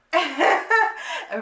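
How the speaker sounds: high, short burst of laughter. Real, not acted. Woman reacting to an insulting text she just read, laughing.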